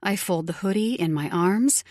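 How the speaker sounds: plain reading voice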